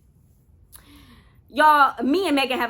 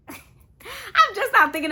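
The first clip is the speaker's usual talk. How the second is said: laughing; 10 semitones higher, 4 dB louder, much slower than usual. Real, not acted. Laughing at celebrity sighting.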